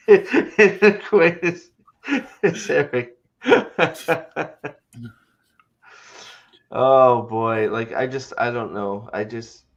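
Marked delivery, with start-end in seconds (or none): 0.1-4.8 laughing through the words; can barely get it out for laughing. Real, not acted. Laughing while naming cast.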